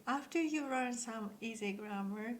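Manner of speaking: ordinary talk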